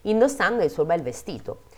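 lilting fairy-tale reading; animated storytelling with swooping pitch; happy-ending narration. A narrator's reading. Reads the happy ending aloud.

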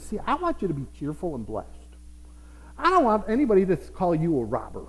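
ordinary talk

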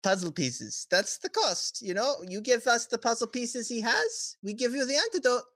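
high, lilting dealmaker voice; bargaining villain. Acted. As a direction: pitch the deal with airy, rising cheer. Villain offers antidote deal.

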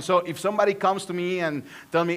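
ordinary talk, nothing marked

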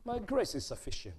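a quiet, settled answer from above; hushed voice of God. Acted. Preacher voicing God answering a begging believer, softly. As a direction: speak God's reply softly and calmly.

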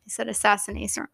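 ordinary talk

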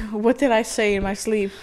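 normal talking voice

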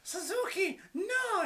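very high falsetto squeal of protest; mock geisha girlfriend. Wrestler's girlfriend scolding him in a retold bit, high mock-female voice. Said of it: "geisha girlfriend was just like".

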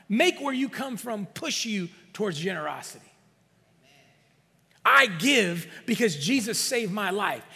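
forceful testimony, building to a loud peak; impassioned preaching. Real, not acted. Pastor telling the church why he gives, preaching fervently. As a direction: preach it with driving, repeated emphasis.

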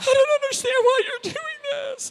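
high, pleading cry; sobbing woman. Retold or imitated. Preacher voicing the woman breaking down over groceries. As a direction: voice a broken woman in tears. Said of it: "she starts sobbing".

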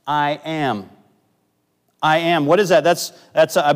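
preaching. Acted. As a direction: proclaim it to the hall.

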